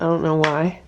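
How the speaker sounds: normal talking voice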